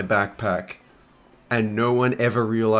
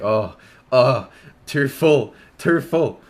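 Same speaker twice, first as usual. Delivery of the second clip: voicing his bladder; 3 semitones higher, 4 dB louder, slower than usual. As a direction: let the bladder moan that it's full. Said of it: "'cause my bladder was like".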